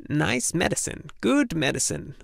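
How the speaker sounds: lightly voiced old crone mumbling medicine. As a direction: coax like a tiny wrinkled healer. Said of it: "mumbled the wrinkled little lips".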